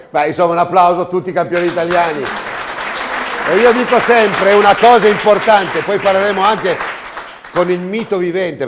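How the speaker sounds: loud, high, booming presenter; showy game show host. Acted. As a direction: hype up the crowd like a TV host. Host calls for applause.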